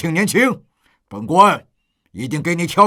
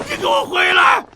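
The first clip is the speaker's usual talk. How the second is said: very high, strained call; desperate shout. Acted. Bereaved father calling the killer back, desperate shout. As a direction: scream after someone walking away.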